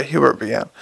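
ordinary delivery, nothing marked

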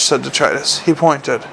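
plain reading voice

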